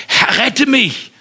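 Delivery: high, loud, slow cry "Herr, rette mich!"; drowning man's shout for help; desperate cry. Acted. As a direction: scream "rette mich" while sinking. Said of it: "schrie er".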